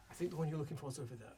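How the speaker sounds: soft, hurried murmur; quiet redirection. Acted. Character deflecting attention onto another, quick and hushed.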